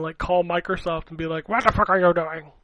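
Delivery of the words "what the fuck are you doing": mild mock-outraged complaint; mock angry. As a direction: pretend to chew out customer support. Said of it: "get mad and".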